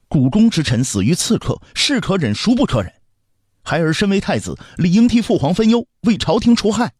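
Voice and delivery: put-on very high, forceful boy's voice; impassioned child prince. Young prince urges his father to act, impassioned. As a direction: press the case with growing fervour. Boy prince argues with emperor.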